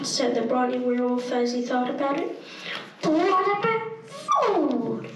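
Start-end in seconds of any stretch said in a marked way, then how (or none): 3.0-5.1 high, whiny plea; whining. A narrator's reading. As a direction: whine it like a hungry pup. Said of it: "whined the skinny werewolf".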